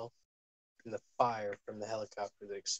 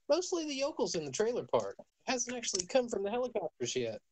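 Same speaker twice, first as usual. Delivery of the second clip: a much higher, hammed-up yokel country accent; 10 semitones higher than usual. Game master describing yokels firing guns, country accent. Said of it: "You are really milking the stereotypical South person".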